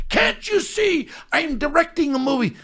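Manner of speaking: high-pitched director's outburst; retold angry shout. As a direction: imitate a director yelling at an interrupter. Director snapping at him, retold.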